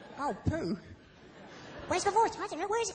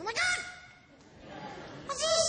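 puppet voice; 8 semitones higher, much slower than usual. Acted. Puppet Sam reacts to crowd.

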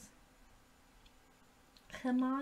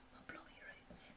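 breathy whisper of the same line; hushed whisper; 24 dB quieter than usual. Acted. Vlogger answering her own roar with an eerie whisper. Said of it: "go back to my roots".

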